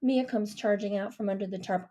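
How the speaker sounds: plain reading voice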